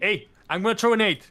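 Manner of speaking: loud, high callout over the noise; clear grenade callout. Shown as a demonstration. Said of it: "Something that is very understanding and easy to".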